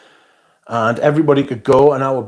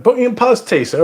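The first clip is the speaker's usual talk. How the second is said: mock posh shop assistant; 13 semitones higher, faster than usual. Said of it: "they'd be like".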